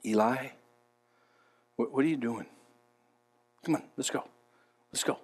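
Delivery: very soft, low coaxing; delicate voice of God. Acted. Preacher voices God urging weary Elijah onward, hushed and coaxing. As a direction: nudge him along in a low hush, unhurried. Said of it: "But God said".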